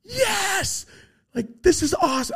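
very high, gleeful yes; thrilled. Retold or imitated. Watching the beings meet Rosie, high thrilled yes. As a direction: burst with delight. His joy at the meeting.

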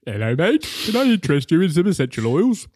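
loud, high put-on cat voice; junkyard cat salesman. Cat greeting a human with a sales pitch, high silly voice. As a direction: pitch it up like a chatty cat making a sale. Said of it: "He goes".

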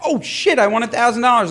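high, thrilled exclamation; excited shout. Retold or imitated. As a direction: yell out the win. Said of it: "I was like".

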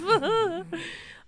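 loud high burst of laughter. Real, not acted. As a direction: cackle out loud. Laughing at story.

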